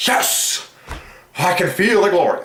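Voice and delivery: overjoyed cry of praise; rapturous. Real, not acted. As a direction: shout it as if filled with the spirit. Overcome by glory.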